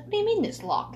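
high, surprised question from mom; mom's disbelief. Retold or imitated. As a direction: mom can't believe the washroom is locked. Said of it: "she's like".

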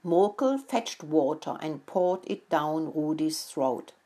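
plain reading voice

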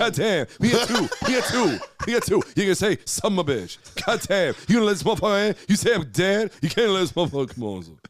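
doing Bernie Mac outraged at the restaurant door. Retold or imitated. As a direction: rant it like Bernie Mac on stage. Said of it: "make a reservation as Bernie Mac".

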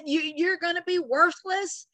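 ordinary talk

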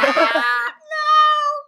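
high laugh that blows out the mic; loud shrieking laugh. Real, not acted.

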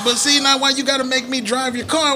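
high, aggrieved complaint; hammed-up whining accusation; whiny blame. Larry whining at his manager about being made to drive.

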